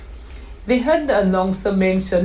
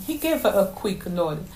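ordinary delivery

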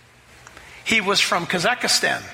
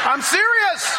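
much higher, laughing through the words; 13 semitones higher, 4 dB louder, slower than usual. Real, not acted. Pastor laughing while telling the congregation he's serious. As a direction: laugh out the protest.

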